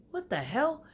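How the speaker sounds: high, puzzled what-the-hell; high startled question; surprised. Retold or imitated. Woke up laughing, confused.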